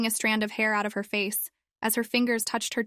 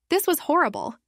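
usual speaking voice

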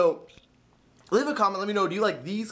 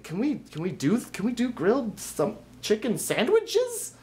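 a hesitant customer asking, pitched well up; mock customer voice; 7 semitones higher than usual. Mimicking a friend ordering from the manager, high and halting. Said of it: "She's like".

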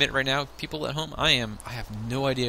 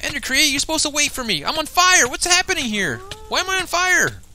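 high, loud, alarmed shouting; panicked cry; 11 semitones higher, 7 dB louder than usual. Pretend. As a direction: cry out as if you're in danger. On fire, dragon in game.

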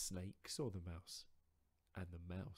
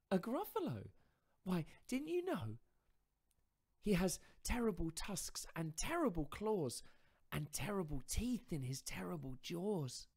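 higher, louder mouse voice, spinning a tall tale; boastful little mouse; 11 semitones higher, 7 dB louder than usual. Acted. Mouse inventing a monster to frighten fox, higher and louder.

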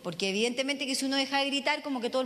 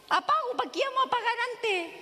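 put-on higher, cheeky teenage voice; cheeky daughter; 9 semitones higher than usual. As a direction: toss it off like a clever kid.

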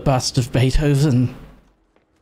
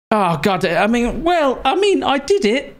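high relieved excitement; 10 semitones higher than usual. Real, not acted. Shaky relief after chaos.

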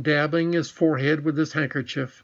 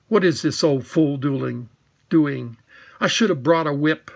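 plain reading voice

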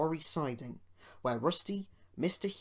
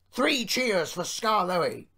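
loud, high, drawn-out rallying call; rally the crowd for a cheer; slow hearty hurrah; 6 semitones higher, 9 dB louder, slower than usual. A narrator's reading. Owner calls for cheers.

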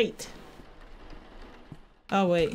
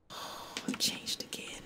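scared whisper; 14 dB quieter, slower than usual. Real, not acted. Whispering in dark horror scene.

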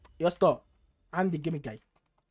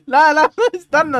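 higher, louder, laughing; laughing protest; 7 semitones higher, 7 dB louder than usual. Real, not acted. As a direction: protest while still laughing. Оправдывается со смехом.

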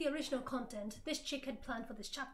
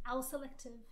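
ordinary delivery

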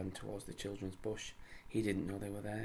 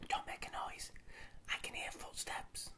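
urgent whisper; 3 dB quieter than usual. Acted. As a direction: whisper so nobody hears. Beth hushing the others.

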